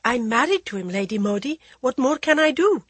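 put-on timid and pleading young woman; young wife voice. Jaya asks.

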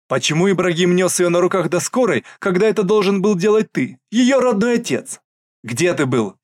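high, loud accusing questions; accusing outburst. Acted. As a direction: hurl each question at him. Sister accuses brother. (обвиняющий крик)

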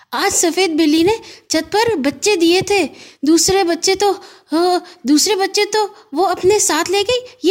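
put-on high, hurried boyish explaining; a boy explaining his rescue in a rush; young boy Gaggu, still winded. Gaggu tells his sister how he saved the kitten, high and hurried. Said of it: "ان کا سانس پھولا ہوا تھا".

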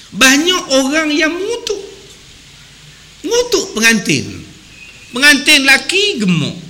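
usual speaking voice

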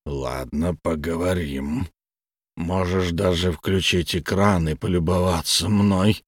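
low, slow sour grumble; a sulky monster, lightly voiced; grumpy blob creature. A narrator's reading. Munster the blob speaks.

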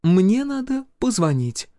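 slow, very high female voice; composed healer. A narrator's reading. Healer tells armed major she must call, composed. Healer calmly insists on calling.

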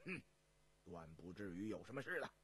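low, soft imperial scoff; deep-voiced, unworried monarch; dismissive emperor. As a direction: brush off the warning quietly. Emperor brushes off the warning.

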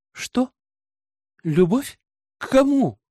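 three startled questions, leaping high; high, incredulous questioning; baffled surprise. A narrator's reading. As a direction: echo it in disbelief. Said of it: "От удивления у меня округлились глаза".

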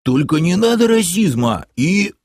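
high, indignant character voice; aggrieved high-pitched protest; protesting half-orc. A narrator's reading. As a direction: object in an offended whine, breaking off. Half-orc protests, gets cut off.

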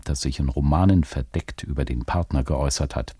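ordinary talk

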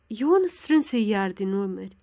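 plain reading voice

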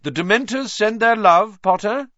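high, loud sneering shout; taunting shout. A narrator's reading. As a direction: jeer it across a crowd. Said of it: "who shouted through the crowd".

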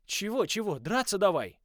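high, loud teasing challenge; taunting. A narrator's reading. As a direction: goad her into the fight. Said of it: "подзадорил я".

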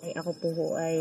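ordinary talk, nothing marked

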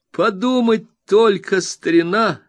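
exclaiming. A narrator's reading. Traddles tells Copperfield about the missed ceremony, excited high exclamation. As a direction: spill the news in excitement. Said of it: "воскликнул Трэддлс".

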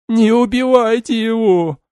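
very high, loud desperate scream; mother screaming for mercy; frantic shouting hostage taker. A narrator's reading. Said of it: "обезумела женщина".

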